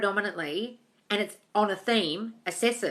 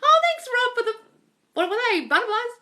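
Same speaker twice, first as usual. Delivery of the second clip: delighted thanks; 6 dB louder than usual. Real, not acted. Thanking viewer for gift.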